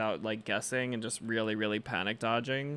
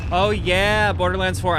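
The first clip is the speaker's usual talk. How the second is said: loud, high burst of excitement; suddenly thrilled, much louder and higher; excited outburst; 8 semitones higher, 10 dB louder than usual. Real, not acted. Sudden excitement over Borderlands.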